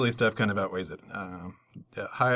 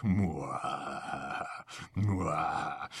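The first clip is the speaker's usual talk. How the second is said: chef's kiss; 6 semitones lower, 5 dB quieter than usual. Acted.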